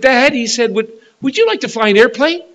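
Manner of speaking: high-pitched, lightly voiced child's question; eager little boy. Little son asks his father about flying, lightly voiced boy. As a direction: pipe up like a small son asking Dad. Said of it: "John said".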